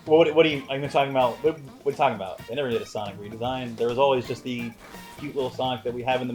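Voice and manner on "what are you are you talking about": ordinary talk, nothing marked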